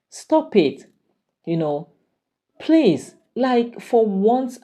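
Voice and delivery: high, pleading stop it; exasperated plea. Retold or imitated. Friend urging Chinwe to let go, high exasperated plea. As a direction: beg her to drop the hate.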